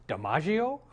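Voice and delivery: questioning rise on the name; puzzled dog. Acted. Dog thrown out on the street guessing again, puzzled. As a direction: offer it as an unsure second guess.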